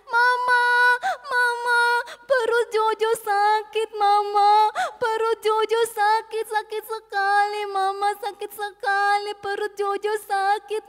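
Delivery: put-on high, drawn-out wail of a child; acted tearful wailing for mother; crying out. A narrator's reading. Jojo calls mama over unbearable bellyache, crying child wail. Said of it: "dia teriak, dia menangis".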